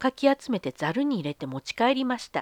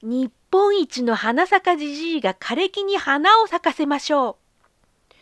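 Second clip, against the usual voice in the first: proud call; 5 semitones higher than usual. Acted. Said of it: "大きな声で言いました".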